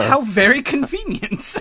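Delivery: high, slow, laughing delight; mock delight. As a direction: savor it as the tickled customer. Acts the delighted customer, laughing.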